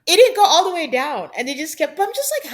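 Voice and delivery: ordinary delivery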